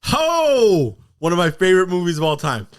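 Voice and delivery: high, loud whoop of delight; excited. Real, not acted. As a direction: burst out about a beloved favorite. Delighted reaction to favorite movie title.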